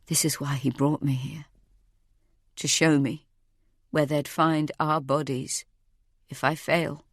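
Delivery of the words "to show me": ordinary delivery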